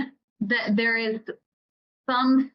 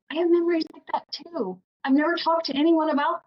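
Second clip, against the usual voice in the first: lifted high, confiding reader voice; eager readers; 7 semitones higher, faster than usual. Retold or imitated. Readers telling the author their secret, pitched up and eager. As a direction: voice readers who finally feel understood. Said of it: "people saying".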